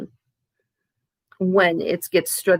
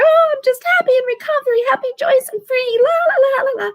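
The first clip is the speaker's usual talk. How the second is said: much higher, fast sugary sing-song parody; mock cheerful. Mocking always-happy recovery YouTubers, high sugary sing-song parody. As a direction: ham up a chirpy always-happy voice with la la la. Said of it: "these people are on there".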